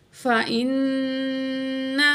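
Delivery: فَإِنَّ with the نّ held in the nose; nasal ghunna. Shown as a demonstration. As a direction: hum the نّ of فَإِنَّ through the nose. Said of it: "غنہ ناک میں آواز لے جا کر". (فَإِنَّ میں نون کو ناک میں ٹھہرا کر غنہ)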